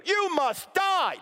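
high, slow mock verdict; mock angry mob. Preacher mocking a crowd condemning Jesus, slow and high. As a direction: drag it out like a pantomime villain.

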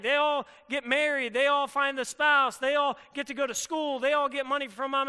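sulking victim, mocked; mock pity party. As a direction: whine that everyone else has it better. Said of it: "you just throw this pity party".

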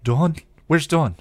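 high, loud urgent question; sharp, worried demand. A narrator's reading. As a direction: demand an answer in alarm. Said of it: "Buffy demanded".

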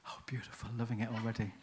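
breathy whispered aside, barely audible. Real, not acted. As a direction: drop to a whisper, pleased. Aside to runners in audience.

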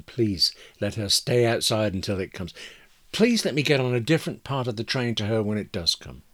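usual speaking voice

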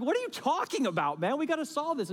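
high, panicky mock protest; frantic generals. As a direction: play the generals freaking out. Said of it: "they're frantic, they're freaking out".

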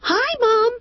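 very high bright chirp of a daughter. Acted. Daughter Renee greeting her mom, high chirp. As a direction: chirp it way up high like a happy girl. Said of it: "Renee chirped".

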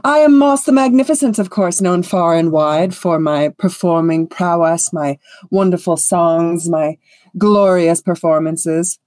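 grand, boastful self-introduction, drawing out the praise; self-important bard. Acted. Vain bard announcing himself to a fellow performer, grandly. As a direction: savour your own magnificence.

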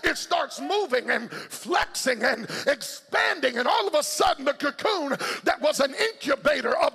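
high, driving gospel shout; full-force preaching. Acted. Preacher rouses congregation to break out of the cocoon, shouted preaching.

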